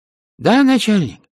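high, loud squeaky underling on the line; distant voice. A narrator's reading. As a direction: pipe up as a far-off minion. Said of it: "послышался далёкий голос". (далёкий высокий голос подчинённого)

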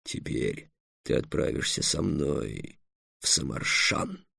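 low, slow, hushed proclamation; solemn. Acted. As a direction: announce it solemnly as the dragon. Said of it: "торжественно сказал Эллен". (торжественно, низко и медленно)

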